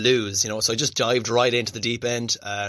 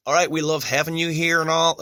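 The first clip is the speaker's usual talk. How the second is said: a hint of a put-on American accent, higher; lightly put-on American cousin voice; bad American accent; 6 semitones higher than usual. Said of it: "that's horrible accent".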